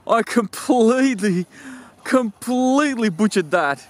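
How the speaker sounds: laughing through the words, high and slow. Real, not acted. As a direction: crack up while admitting the mistake. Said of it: "I'm laughing at my own silliness".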